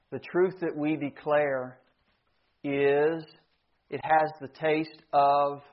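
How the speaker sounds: measured, hushed gravity with long pauses; solemn preaching. Real, not acted. Preacher weighs death against life, solemn preaching.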